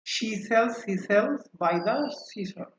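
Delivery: joined up, still carefully measured. Shown as a demonstration. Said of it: "আমরা একটু দ্রুত উচ্চারণ করি".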